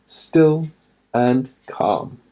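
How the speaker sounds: usual speaking voice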